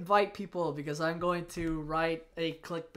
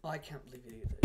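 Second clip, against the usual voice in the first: ordinary talk, nothing marked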